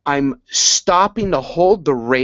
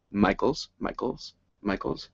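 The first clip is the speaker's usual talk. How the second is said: hushed recognition; 5 semitones lower, 10 dB quieter than usual. Retold or imitated. Ticking off faces in article.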